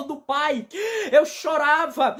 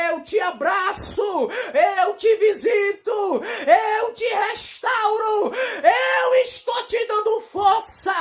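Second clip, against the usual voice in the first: slow, higher, shouted proclamation; fiery preaching; 5 semitones higher, slower than usual. Acted. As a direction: cry out each promise slowly. Preaching God's words at full force.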